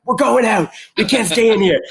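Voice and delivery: high, loud excited yell; elated outburst. Retold or imitated. Fan to partner after the winning shot, shouting excitedly. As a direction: burst out as if too thrilled to stay put. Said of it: "And then after that".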